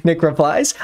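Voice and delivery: usual speaking voice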